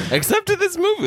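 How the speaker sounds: high, playful mock voice. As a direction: say it sing-song and high. Mock defence of meanness.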